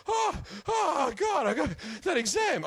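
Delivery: high, gasped alarm; mock waking panic. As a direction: wake with a start, hammed-up and high. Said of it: "you wake up like".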